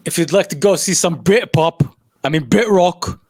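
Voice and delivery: put-on a deliberately awful British accent; bad British accent. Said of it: "My British accent is horrible".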